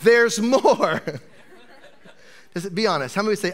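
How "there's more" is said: loud, rising pitchman hype; infomercial voice. Pretend. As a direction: sell it like a TV pitchman. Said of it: "It sounds like an infomercial".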